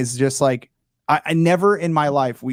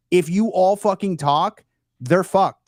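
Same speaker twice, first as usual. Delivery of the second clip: higher, punched-up rallying line; emphatic urging; 5 semitones higher, 4 dB louder, slower than usual. Retold or imitated. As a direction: press the point hard to rally fellow creators. Acted urging of other creators.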